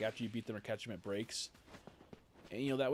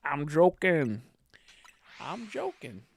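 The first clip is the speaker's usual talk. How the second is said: very loud catchphrase, then high and less loud; hammed-up, drawn-out "joking"; imitating sketch professor; 4 semitones higher, 12 dB louder, much slower than usual. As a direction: blurt it big, then echo it pitched up. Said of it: "But he goes".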